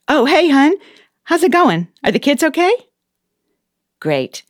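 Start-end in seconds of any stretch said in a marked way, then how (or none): 0.1-2.7 higher and brighter, all sweetness for her husband; sweet phone voice. Acted. Boss answers a call from her husband, sweet and higher. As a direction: switch into the loving-wife voice.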